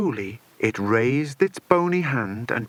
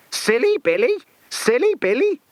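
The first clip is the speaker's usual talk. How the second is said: much higher, louder squawking parrot voice; cackling parrot; 12 semitones higher, 4 dB louder than usual. A narrator's reading. Parrot Kiki jeering at the villain, cackling with delight. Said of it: "cackled Kiki in delight".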